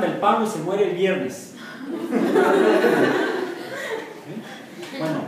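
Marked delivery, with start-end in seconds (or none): 1.6-4.2 high breathless burst of laughter. Real, not acted. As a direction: laugh high and airy at your own joke. Class joke about resurrection.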